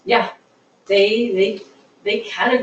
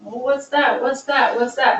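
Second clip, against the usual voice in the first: a lightly acted onlooker, pitched up; pitched-up, repeated curious asking; 5 semitones higher than usual. Kid eyeing others' ice cream choices, mildly acted curiosity. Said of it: "you're looking at everybody else going".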